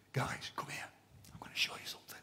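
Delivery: conspiratorial whisper to friends. Acted. Jesus whispering to his disciples, letting them in. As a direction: lean in and whisper the secret. Jesus voiced sharing a secret.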